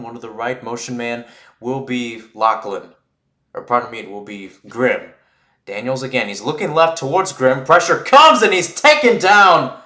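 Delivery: ordinary delivery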